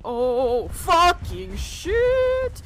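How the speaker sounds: very loud, high shriek; drawn-out terrified yell; startled scream. Real, not acted. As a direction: scream the curse, stretching it right out. Monster jumps out.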